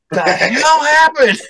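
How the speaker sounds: high, slow, dazed 'what the hell'; like you just woke up and lost three hours; drawn-out confused squeak; baffled disbelief. Retold or imitated. Waking up three hours later.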